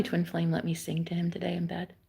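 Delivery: hushed, breathy whisper. Real, not acted. Telling the viewers about singing to him, whispered. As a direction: whisper it like a secret.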